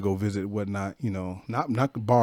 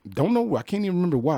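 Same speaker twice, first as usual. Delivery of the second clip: normal talking voice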